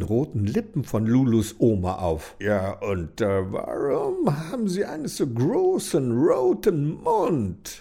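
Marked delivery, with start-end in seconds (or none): 2.4-7.8 put-on nervous hesitant young man; stammering hunter. A narrator's reading. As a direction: stumble over the words shyly.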